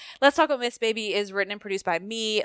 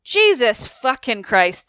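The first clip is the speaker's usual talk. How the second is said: exasperated curse; 3 semitones higher, 10 dB louder, slower than usual. Real, not acted. Exasperated outburst about world news.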